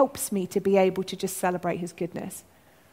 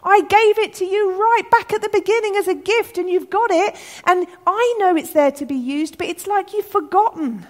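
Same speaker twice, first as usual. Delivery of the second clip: high-pitched, raised, pleading voice; God as Father shouting; 10 semitones higher, 5 dB louder than usual. Retold or imitated. Father reminding his kids of a forgotten gift, exclaiming. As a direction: remind them insistently, voice lifted high. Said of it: "God as Father shouting".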